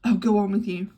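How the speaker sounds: giddy flirt crying out; coy cry. Acted. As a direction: cry out playfully. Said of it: "cried Miss Taylor".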